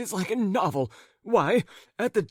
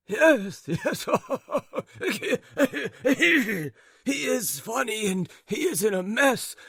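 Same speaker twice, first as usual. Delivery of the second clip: higher, laughing between words; laughing old man; 7 semitones higher than usual. A narrator's reading. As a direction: laugh along with wife.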